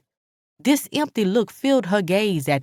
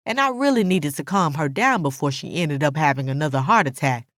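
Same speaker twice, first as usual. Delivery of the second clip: usual speaking voice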